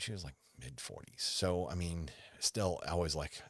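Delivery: ordinary talk, nothing marked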